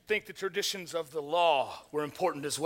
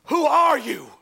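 a frightened question, higher and louder; trembling; 7 semitones higher, 6 dB louder, slower than usual. Acted. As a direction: ask in awe and terror. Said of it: "I trembled and I said".

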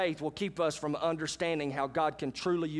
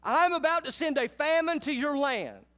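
prophet Amos; 8 semitones higher, 4 dB louder than usual. Acted. Amos shouting God's warning to Israel, loud and high. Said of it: "Amos speaking".

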